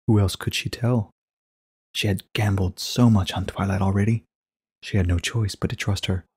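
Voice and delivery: ordinary delivery, nothing marked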